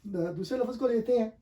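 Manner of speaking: ordinary talk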